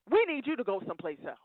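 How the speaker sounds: ordinary talk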